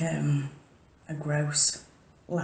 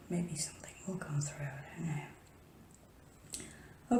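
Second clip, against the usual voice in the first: whispering; 13 dB quieter, slower than usual. Real, not acted. Trailing whisper.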